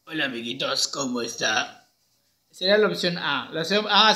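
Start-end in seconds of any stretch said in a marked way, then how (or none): 0.1-1.9 trial character voice. Shown as a demonstration. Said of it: "podría ser una voz así". (voz grave y lenta de personaje)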